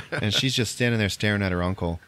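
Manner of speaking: ordinary talk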